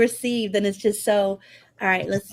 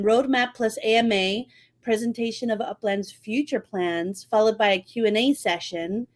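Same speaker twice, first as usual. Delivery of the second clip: usual speaking voice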